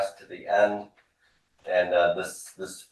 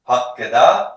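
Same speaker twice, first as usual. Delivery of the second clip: loud, raised pitch, lifting at the end; repeat-after-me model; 4 semitones higher, 8 dB louder than usual. Shown as a demonstration. Teacher leading a class drill, loud rising model word. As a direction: prompt the class to echo you. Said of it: "Repeat after me".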